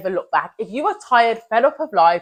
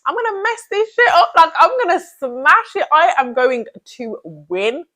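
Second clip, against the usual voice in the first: much higher, louder, triumphant self-pep; audacious hype; 8 semitones higher, 3 dB louder than usual. Retold or imitated. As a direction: shout your confidence like you already won. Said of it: "Have the audacity to then be like".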